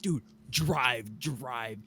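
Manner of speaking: normal talking voice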